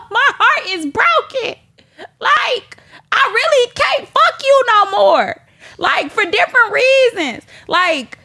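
high, half-laughing wail of heartbreak; comic high-pitched lament; heartbroken wail. Real, not acted. Laughing wail about being heartbroken.

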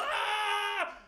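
high excited shriek; yell. Retold or imitated. Hugging Michelle, high excited shriek. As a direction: let out a thrilled scream. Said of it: "I yell".

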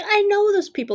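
very high, loud thrilled recognition; excited recognition. Retold or imitated. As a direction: shriek it high like spotting friends on a taxi. Own reaction, DiCaprio meme.

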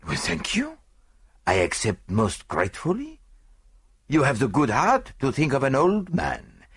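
put-on foreign-accented old friend, deliberate phrasing; grateful foreign detective. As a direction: accept graciously in an accented character voice. Voices Poirot accepting.

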